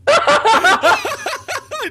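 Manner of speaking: slow words through laughter; laughing. Real, not acted. Laughing at friend's form.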